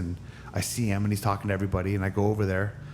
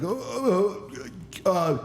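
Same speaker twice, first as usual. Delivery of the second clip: high, stammering fumble for words; acted tongue-tied stutter; flustered flubbing; 6 semitones higher, slower than usual. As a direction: trip over every syllable, caught off guard.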